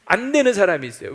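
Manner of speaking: usual speaking voice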